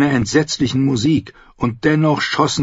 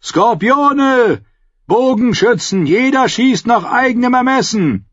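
high shouted battle orders; calling out; 12 semitones higher, 3 dB louder than usual. A narrator's reading. Commander to his archers in battle, calling out. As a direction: shout across the wall to the troops. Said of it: "rief er".

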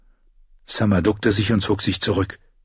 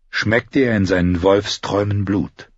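usual speaking voice

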